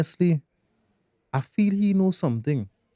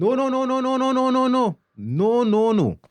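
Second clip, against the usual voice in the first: panicked denial; 8 semitones higher than usual. Acted. Father refuses to accept it.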